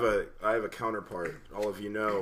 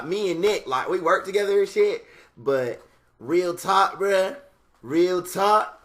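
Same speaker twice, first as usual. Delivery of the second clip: higher, louder street persona voice; Jamichael voice; 6 semitones higher, 4 dB louder than usual. Retold or imitated. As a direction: slip into the loud street persona. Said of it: "sometimes I'm Jamichael".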